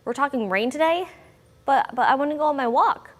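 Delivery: high, pleading little dog voice; puppy voice. Retold or imitated. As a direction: voice the pup begging for her walk. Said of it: "puppy voice is coming out".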